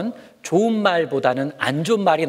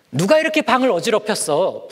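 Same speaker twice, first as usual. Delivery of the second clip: louder, higher stern question; scolding parent; 8 semitones higher, 4 dB louder than usual. Acted. Parent scolding about the messy room, louder and higher. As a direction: demand it like a cross parent. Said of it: "부모님이 말씀하시면".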